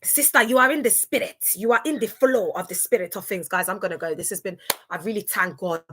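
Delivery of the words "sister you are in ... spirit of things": ordinary talk, nothing marked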